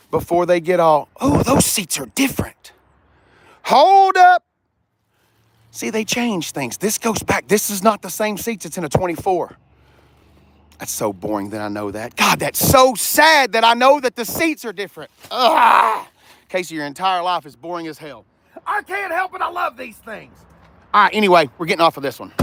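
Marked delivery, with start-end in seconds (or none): 1.2-4.4 higher, louder sudden stop; surprised. Real, not acted. Noticing the seats changed. 18.7-20.3 higher, whiny plea; playful protest. Real, not acted. Defending his car obsession.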